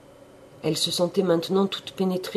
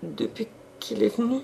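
put-on halting, high young maid's voice; stammering maid; 7 semitones higher than usual. A narrator's reading. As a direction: stammer it out timidly, voice lifted. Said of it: "Rosalie balbutia".

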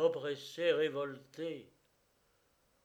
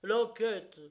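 high, loud bursts of protest; angry crowd shouts; 8 semitones higher, 12 dB louder than usual. A narrator's reading. The crowd advances on the gendarmes, shouting angry demands. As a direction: shout each demand like a surging mob. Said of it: "gronda la foule en s'avançant".

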